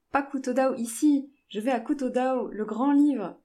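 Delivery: insistent, high and loud protest; arguing. A narrator's reading. Jane argues with the coolies about the destination, insistent. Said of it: "Elle argumenta avec les coolies".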